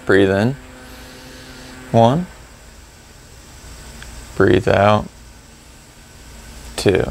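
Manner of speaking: slow, measured cues with long pauses; guided breath counting. Shown as a demonstration. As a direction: count the breaths calmly, rising on one, dropping on two. Leads paced breath counting.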